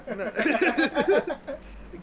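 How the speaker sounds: loud, high burst of laughter; hearty laugh. Real, not acted. Laughing at own joke.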